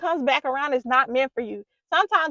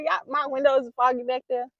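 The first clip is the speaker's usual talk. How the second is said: ordinary talk, nothing marked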